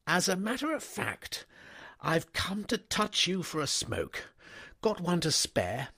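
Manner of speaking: put-on an easygoing young master stopping his valet; young toff. A narrator's reading. Wooster cadging a cigarette.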